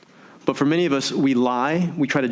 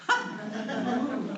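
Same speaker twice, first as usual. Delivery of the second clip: laugh; 11 semitones higher, 9 dB quieter than usual. Real, not acted. Prize announcement, laughing.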